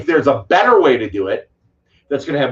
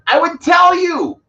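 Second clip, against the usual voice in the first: loud, much higher, slow "I would tell you"; slow, insistent, raised voice; emphatic shout; 5 dB louder, slower than usual. Real, not acted. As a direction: nearly shout it to drive the point home. Emphatic rant about pay for delete.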